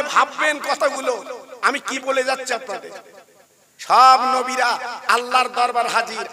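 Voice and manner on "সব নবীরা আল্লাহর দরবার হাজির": ordinary delivery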